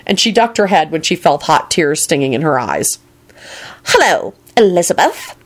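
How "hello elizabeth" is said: officious. A narrator's reading. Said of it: "came an officious voice above her head".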